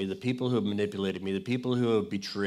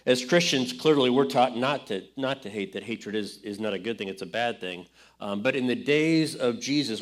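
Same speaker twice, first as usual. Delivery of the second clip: ordinary delivery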